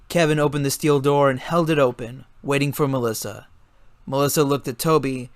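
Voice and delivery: ordinary delivery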